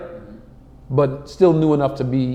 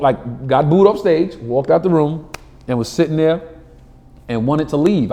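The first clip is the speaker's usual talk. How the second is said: normal talking voice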